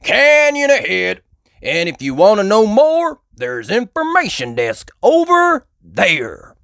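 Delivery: high, loud, slow warden bison; Mister Bison voice. A narrator's reading. Mister Bison pointing the family to the desk, loud and slow. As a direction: spell out the directions slowly. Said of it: "yelled Mister Bison".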